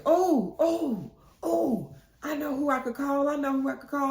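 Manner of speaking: high-pitched, bursting "oh, oh, oh"; excited brainwave. Retold or imitated. Friend realizing who she can call, excited. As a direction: blurt it out like a sudden idea. Friend suddenly has an idea.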